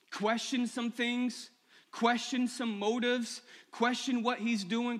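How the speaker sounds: slow, deliberate stress on question; drawn-out preacher's emphasis on question; slow emphatic question. Acted. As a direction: lean hard on each question.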